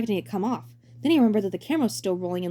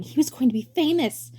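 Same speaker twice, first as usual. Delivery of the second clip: ordinary delivery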